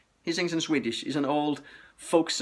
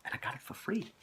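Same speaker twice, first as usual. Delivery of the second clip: playful whispered aside; whispering; 12 dB quieter, faster than usual. Real, not acted. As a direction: whisper it like a secret. Whispered aside about free record.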